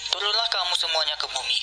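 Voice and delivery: normal talking voice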